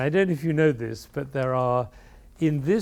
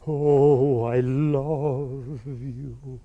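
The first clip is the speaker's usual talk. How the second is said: slow, yearning "don't leave me"; tender plea; much slower than usual. Shown as a demonstration. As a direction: voice the music's longing, drawn out. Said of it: "What he's saying".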